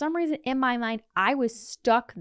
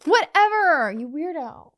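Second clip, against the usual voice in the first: mock retort; 6 semitones higher, 7 dB louder than usual.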